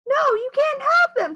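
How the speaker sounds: no, high and loud; high loud refusal. Acted. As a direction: shut him down sharply. Leslie refusing Louis.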